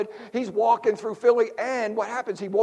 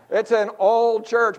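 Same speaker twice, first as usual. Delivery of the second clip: slow, laughing punchline; 3 semitones higher, 5 dB louder, slower than usual. Shown as a demonstration. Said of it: "You gotta be on Social Security".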